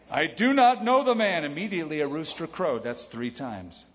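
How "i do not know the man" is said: loud, high-pitched vehement denial; cursing, swearing denial. Acted. Peter's third denial to the bystanders, loud and vehement. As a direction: swear it forcefully, voice jumping up. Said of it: "he began to curse and swear".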